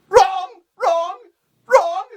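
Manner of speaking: high, loud, separate jabs; outraged correction. Acted. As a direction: yell each word in comic fury.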